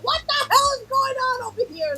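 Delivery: high squeaky voice of a baffled pig, laughing through it. Retold or imitated. Friend voices the startled pig in the movie, high and laughing. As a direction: voice the pig, cracking up at it. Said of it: "And the pig is like".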